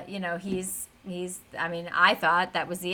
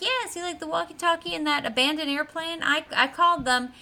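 put-on higher, eager childlike explaining; proud kid; 6 semitones higher than usual. Gus explaining the walkie-talkie call, eager. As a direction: explain it like a pleased little boy. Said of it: "And he's like".

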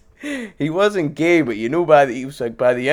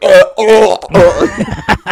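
loud, higher disgusted grunts; mock gagging grunts; 5 semitones higher, 9 dB louder, much slower than usual. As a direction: retch at the smell, loud. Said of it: "they're like".

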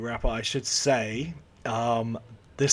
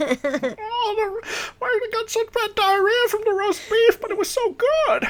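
Adam Sandler's very high, squawky prank voice; hammed-up old lady impression, pitched way up; 4 dB louder than usual. Fan re-enacting the restaurant prank, squealing old lady voice. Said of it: "him doing old lady voice".

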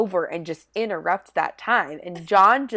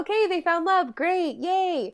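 high-pitched token celebration; higher, half-hearted hooray; mock cheer; 8 semitones higher than usual. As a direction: cheer for them in a raised, pretend-happy voice. Acted cheer for a couple.

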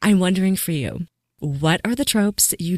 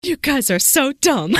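high, louder teasing jab; playful mock insult; 11 semitones higher, 4 dB louder than usual. Reader teasing clueless heroes, high playful jab. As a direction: tease the oblivious characters, laughing.

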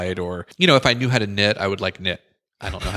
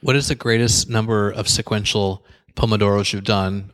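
ordinary talk, nothing marked

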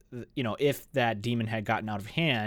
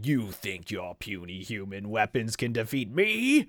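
dragon voice; 6 semitones higher than usual. Retold or imitated. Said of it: "the whole time he's going".

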